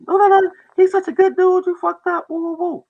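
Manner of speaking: put-on very high, loud old-lady falsetto; imitating grandma. Grandma praising the narrator to her granddaughter, high loud falsetto. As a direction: squeal it like an excited grandma. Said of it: "What you think grandma did?".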